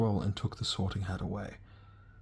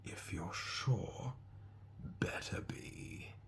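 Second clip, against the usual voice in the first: slow, soft, musing whisper; an old hat thinking aloud; sorting hat whisper; 9 dB quieter, much slower than usual. Acted. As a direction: weigh him up in a hush, right in his ear. Sorting hat speaks in ear.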